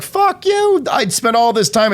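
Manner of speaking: mimicking a defensive videographer lashing back at criticism; mock defensive outburst. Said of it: "And they get defensive".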